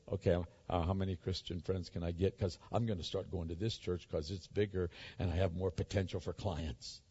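low, soft voice, lightly acted schemer; lightly mock someone plotting to themselves, low and quiet; calculating networker. Preacher imitating a churchgoer hunting for clients, quietly calculating.

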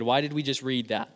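ordinary talk, nothing marked

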